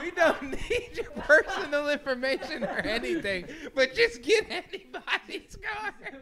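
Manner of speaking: high, loud mock caller through laughter; imitated scam caller. Scammer pleading for any card, high laughing imitation. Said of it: "they're like".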